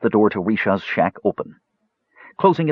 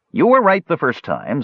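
higher, lightly teasing reply; hint of playfulness; 8 semitones higher than usual. A narrator's reading. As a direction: play along with the joke. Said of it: "with a hint of playfulness".

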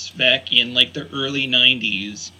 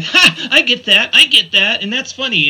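high, louder burst of glee; pitched-up, excited self-quote; delighted recognition; 10 semitones higher, 5 dB louder than usual. Retold or imitated. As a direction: reenact a thrilled "I get it" moment. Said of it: "So I was like".

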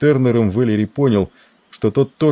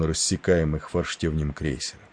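usual speaking voice

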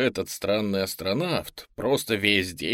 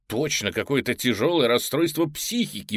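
usual speaking voice

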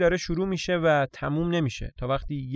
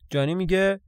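ordinary delivery

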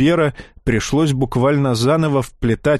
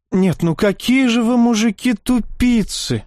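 indignant; 9 semitones higher than usual. A narrator's reading. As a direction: scold the men, exasperated. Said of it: "возмутилась Дарья". (возмущённо)

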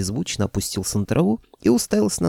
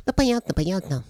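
piping, much higher creature voice; high dragon head; 10 semitones higher than usual. A narrator's reading. Middle head giving in to the right one, high-pitched. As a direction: squeak "понятно, понятно" back in falsetto.

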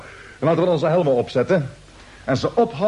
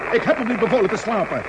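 normal talking voice

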